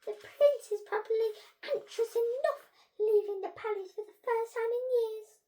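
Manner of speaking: high, loud teacherly voice; stern head teacher. A narrator's reading. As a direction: lecture the pupils, pitched well up. Head teacher scolds students.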